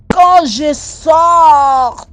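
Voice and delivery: loud and drawn-out, building suspense; telling friends the scary moment, slow loud build; dramatic slow shout. Real, not acted. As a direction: stretch it out loud, holding the crowd. Dramatic climax to listeners.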